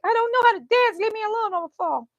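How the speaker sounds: high-pitched retold protest; mock panic. Woman dragged around dancing by brother, panicked protest. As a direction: squeal it in comic panic.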